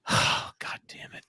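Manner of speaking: slow, whispered sigh of resignation; weary retold exasperation. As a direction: breathe the curse out slowly under your breath. Retold weary reaction to explosion.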